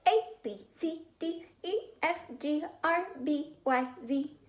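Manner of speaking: put-on high singsong little boy reciting letters; a small kid showing off the alphabet; child reciting. A narrator's reading.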